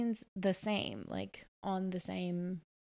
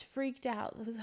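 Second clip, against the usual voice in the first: ordinary delivery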